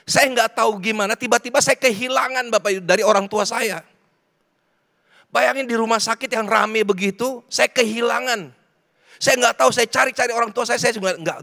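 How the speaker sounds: impassioned preaching. Real, not acted. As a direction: preach it high and urgent to a crowd. Preaches at full force. (khotbah berapi-api dengan nada tinggi)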